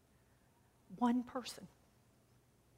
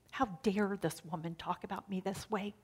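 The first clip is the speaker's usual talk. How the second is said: ordinary talk, nothing marked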